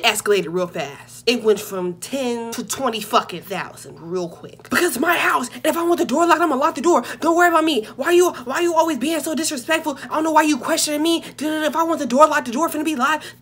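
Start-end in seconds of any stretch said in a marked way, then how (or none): 4.7-13.4 higher-pitched mock tirade, words tumbling out; indignant rant. Relative snaps back over the locked door, indignant. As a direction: rattle it off like an offended householder. Relative's angry escalation acted out.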